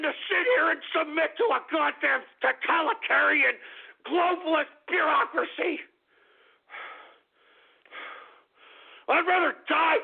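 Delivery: high, impassioned yell; real worked-up shouting; upset, angry shout. Real, not acted. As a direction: cry it out in furious defiance. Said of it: "sorry for getting so upset".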